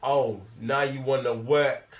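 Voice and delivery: ordinary talk, nothing marked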